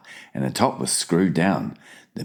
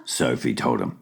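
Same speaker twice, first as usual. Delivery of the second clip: ordinary delivery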